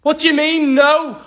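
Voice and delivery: high, loud incredulous demand; panicked outburst. Acted. Submarine pilot learning nobody brought the charger, panicked outburst. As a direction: burst out in alarmed disbelief.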